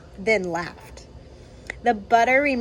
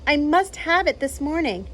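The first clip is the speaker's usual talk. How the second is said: calling out; 8 semitones higher, 3 dB louder than usual. Acted. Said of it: "He called to Missus Burbank".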